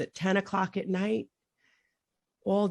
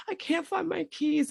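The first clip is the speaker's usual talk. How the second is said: retold desperate call; 9 semitones higher than usual. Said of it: "And I'm calling my son".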